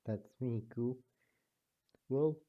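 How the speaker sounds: ordinary delivery, nothing marked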